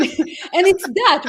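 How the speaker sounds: normal talking voice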